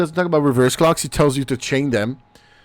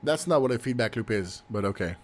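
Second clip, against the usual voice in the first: quiet; 5 semitones lower, 11 dB quieter than usual. Real, not acted. Muttered aside, then repeated.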